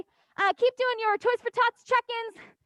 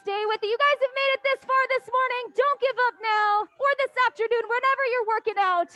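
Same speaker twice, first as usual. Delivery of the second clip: ordinary talk, nothing marked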